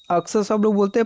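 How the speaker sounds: ordinary talk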